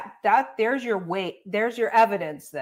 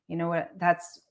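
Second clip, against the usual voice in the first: ordinary talk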